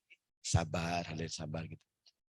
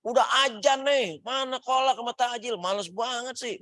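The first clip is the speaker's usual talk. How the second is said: mock nagging, pitched way up; nagging wife; 11 semitones higher than usual. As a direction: scold him like an annoyed wife at iftar. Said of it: "Marah-marah istriku".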